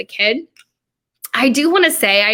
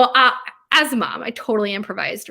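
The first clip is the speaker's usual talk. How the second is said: ordinary talk, nothing marked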